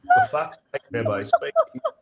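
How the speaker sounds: squealed through laughter, high and loud; can barely get the quote out for laughing. Retold or imitated. Reading a chat comment aloud while cracking up laughing. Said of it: "he said".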